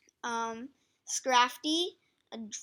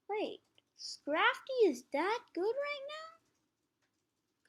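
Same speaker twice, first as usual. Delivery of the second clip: surprised; 11 semitones higher, much slower than usual. Real, not acted. Shocked at Scrafty ranking.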